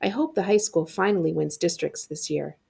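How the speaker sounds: plain reading voice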